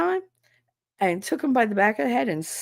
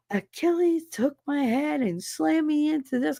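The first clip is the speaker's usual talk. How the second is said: put-on upset kid; 9 semitones higher than usual. Son excitedly reporting being attacked.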